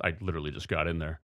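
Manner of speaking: ordinary delivery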